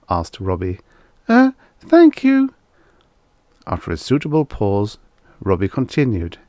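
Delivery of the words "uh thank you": put-on very high, slow little boy; hesitant child's thanks, pitched high; high, loud kid voice; small boy. A narrator's reading.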